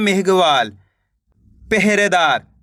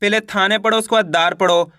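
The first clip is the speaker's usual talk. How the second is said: normal talking voice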